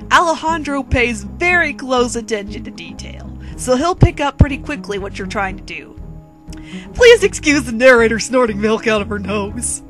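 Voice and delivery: ordinary delivery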